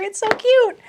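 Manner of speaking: high, delighted coo; melting over something cute; excited. Real, not acted. Gushing over the little deck.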